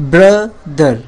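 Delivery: Brother as long bra, gap, দার; two separate parts. Shown as a demonstration. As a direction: stretch and punch bra, then let দার fall. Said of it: "দুইটা অংশ".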